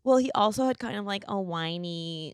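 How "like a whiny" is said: slow, soft whiny drawl. Retold or imitated. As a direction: whine it like an annoying date. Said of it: "he even had a whiny voice".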